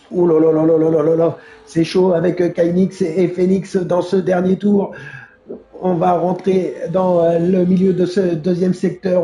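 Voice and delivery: lively live play-by-play; motorsport commentator. Real, not acted. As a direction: call the race as it happens. (commentateur de course automobile)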